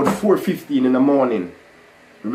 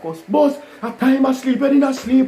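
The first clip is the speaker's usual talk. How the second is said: high squeaky imitation of a pleading friend; mock friend voice; 11 semitones higher than usual. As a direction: ham up the friends begging in a high voice.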